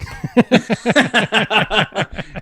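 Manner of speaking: big laugh. Real, not acted. Laughs at own story.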